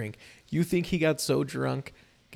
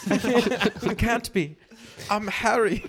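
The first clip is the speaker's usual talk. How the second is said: slow, higher, laughing whine; mock Harry protest; 8 semitones higher, much slower than usual. Harry refuses to be a boxer, slow and laughing. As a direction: plead it slowly through a laugh. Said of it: "You're a boxer, Harry".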